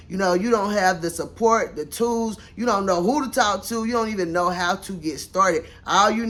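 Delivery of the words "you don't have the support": ordinary talk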